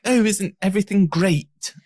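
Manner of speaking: high, hammed-up generic English accent; mock English accent. Mocking Americans who do English accents, in a high mock English accent. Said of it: "when Americans do English accents".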